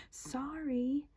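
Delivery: high, soft and sweet apology; nicest voice. A narrator's reading. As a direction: say sorry as nicely as possible. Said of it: "in his nicest voice".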